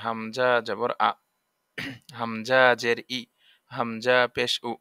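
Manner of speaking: slow, careful syllable drill on হামজা জবর আ; drilled letter sounds. Shown as a demonstration. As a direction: spell out হামজা with each vowel slowly, like a teacher. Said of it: "বারবার এই পড়াগুলো মশকা করতে হবে".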